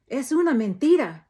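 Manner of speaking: loud, high shout of denial; mother shouts. A narrator's reading. Mother shouts at sheriff, defending daughter. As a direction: hurl it back at him. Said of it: "Mama shouts".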